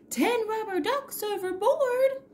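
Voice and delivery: high, far-off captain call; captain's shout. A narrator's reading. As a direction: cry it out across the deck.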